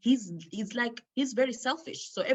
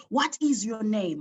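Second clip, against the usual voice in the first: angry demand; 4 semitones higher, 7 dB louder than usual. Retold or imitated. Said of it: "And I got angry".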